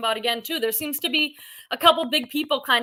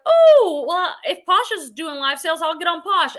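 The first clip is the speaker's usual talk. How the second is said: a high, loud "Oh" that settles into breezy talk; imitating sellers who jump into live sales, starting with a high, loud "Oh"; mock sudden change of heart; 4 semitones higher, 7 dB louder than usual. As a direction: imitate someone switching sides on a whim. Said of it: "they're like".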